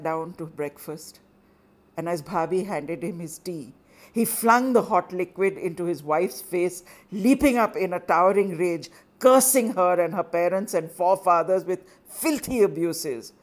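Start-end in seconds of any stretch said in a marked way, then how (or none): none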